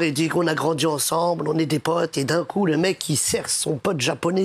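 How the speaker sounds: ordinary talk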